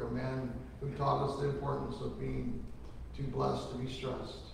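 ordinary talk

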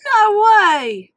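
high, loud disbelieving shout of joy; excited exclamation. Acted. Mater hearing Lightning will stay, loud joy. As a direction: yelp with delighted surprise. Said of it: "Mater exclaimed".

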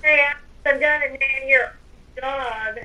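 ordinary delivery

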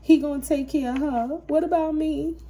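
a reader mocking the gold digger's jealous whine; jealous woman. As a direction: mimic her petty complaint. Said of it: "She like".

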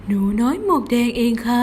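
lightly voiced wolf posing as a little girl; a hint of a sly wolf putting on a sweet voice; wolf faking a girl's voice. A narrator's reading. Wolf at the door tricking grandma, lightly faked sweet voice. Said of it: "หมาป่าดัดเสียง".

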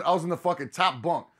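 ordinary talk, nothing marked

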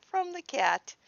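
high amused repeat. Real, not acted. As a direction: say it again, still tickled. Repeats, still delighted.

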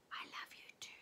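soft breathy secret whisper. A narrator's reading. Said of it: "turned around and whispered".

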